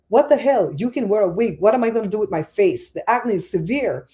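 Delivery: mock-outraged friend protesting; indignant retort. Friend with acne answering the one losing hair, indignant.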